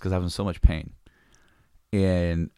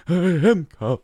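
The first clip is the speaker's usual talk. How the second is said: high, goofy I am calm; dopey drugged reply; 8 semitones higher than usual. Retold or imitated. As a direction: protest like a drugged patient, pitched up. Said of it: "I'm like".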